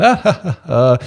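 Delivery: high, fake giggling laugh; mock flirty giggle. Office flirt mocking laughter at the husband's jokes, fake giggle. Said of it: "she's just laughing at all of his jokes".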